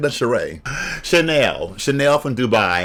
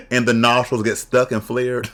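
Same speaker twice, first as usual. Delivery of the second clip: ordinary delivery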